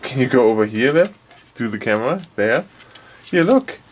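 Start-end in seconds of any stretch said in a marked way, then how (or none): 3.2-3.8 high coaxing "look" to a little dog. Real, not acted. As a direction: pitch it up to catch the dog's eye. Showing the dog the toy.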